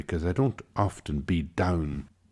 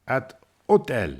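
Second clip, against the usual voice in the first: higher-pitched imitated call; mock shout back; 6 semitones higher than usual. French woman calling back about the hotel, imitated shout. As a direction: finish the called-back goodbye in a raised pitch. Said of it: "one of them shouted back".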